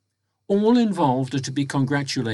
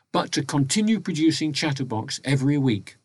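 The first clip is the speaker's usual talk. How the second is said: ordinary talk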